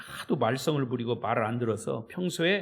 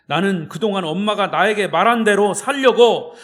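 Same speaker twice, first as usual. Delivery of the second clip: louder, impassioned retelling of the son's cry; son's outburst; 4 semitones higher, 10 dB louder than usual. Retold or imitated. Son cries out his confession to his mother, loud and impassioned. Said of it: "그 아들도 펑펑 울면서 엄마에게 고백을".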